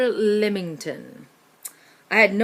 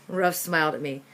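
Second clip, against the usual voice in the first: ordinary delivery, nothing marked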